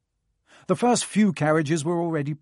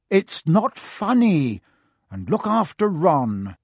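stern scolding; 3 semitones higher, 6 dB louder, slower than usual. A narrator's reading. Mother scolds.

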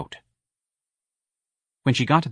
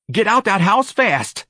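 much higher, louder and slow, an urgent push; urgent order; 12 semitones higher, 5 dB louder, slower than usual. Acted. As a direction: bark it out slowly, urging her to leave. Santa urges her out.

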